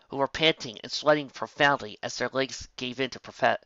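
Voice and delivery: usual speaking voice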